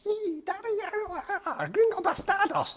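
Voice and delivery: high mock child shouting; little boy voice. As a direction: squeak it like an eight-year-old boy.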